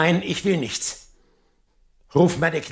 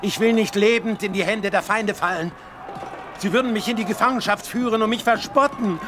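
mounting desperation; 5 semitones higher than usual. Acted. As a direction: refuse capture with rising, desperate intensity. Defeated king dreads capture and mockery. (zunehmend verzweifelt, mit steigender Stimme)

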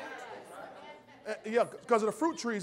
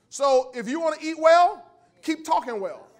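louder, higher preacher build; preaching at full force; 5 semitones higher, 8 dB louder than usual. Acted. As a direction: drive the warning home like a pulpit preacher.

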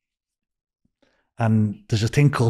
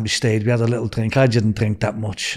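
ordinary talk

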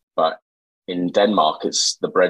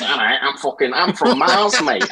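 higher, laughing mock Mancunian "from Mars" voice; Mancunian alien; 5 semitones higher than usual. Mocking the host's alien voice, laughing Mancunian accent. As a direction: greet them as a cheerful Martian from Manchester. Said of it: "Ah, it's Mancunian".

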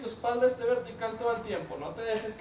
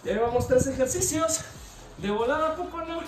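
high, loud pep talk; hyped-up coach; 6 semitones higher, 4 dB louder than usual. Real, not acted. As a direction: pump up the class. Coach hyping the class.

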